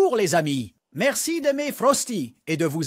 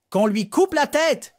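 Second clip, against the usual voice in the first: furious queen's shout; 6 semitones higher than usual. A narrator's reading. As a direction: scream the sentence at her. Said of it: "cria la reine de cœur".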